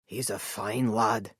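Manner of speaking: low, slow Scots murmur; a soft-spoken older Scot, moved; lightly voiced Scottish man; gentle Scottish man. A narrator's reading. Elias voiced, Scottish companion.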